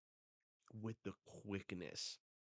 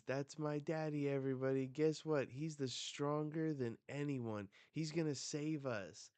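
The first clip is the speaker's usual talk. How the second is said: put-on high, loud, excited kid voice; boasting child; 6 semitones higher, 7 dB louder than usual. Cullen tells everybody about his daddy, boasting excitedly. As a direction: brag loudly as a proud little kid.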